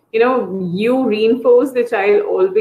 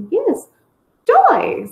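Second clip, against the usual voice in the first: "toy" pitched high and drawn out; emphasis on TOY. Shown as a demonstration. Therapist recorrecting a child's word, emphasis on "toy". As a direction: acknowledge with "Yes", then model "toy" high and clear. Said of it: "the more emphasis was on".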